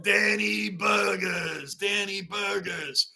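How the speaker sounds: slow, playful drawl of Danny Burgers; slow relished nickname. Shown as a demonstration. Streamer teaching chat the nickname, slow and playful. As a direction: drag out Danny Burgers slowly, savoring it.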